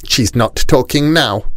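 lightly voiced high-pitched grumbling aunt; muttering aunt. A narrator's reading. Rani's aunt doubting the girl, high grumbling mutter. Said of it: "muttered the Rani's aunt".

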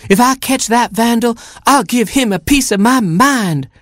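very high, threatening mother hen; high-pitched hen outrage; squawking hen. A narrator's reading. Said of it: "squawked Mama Hen".

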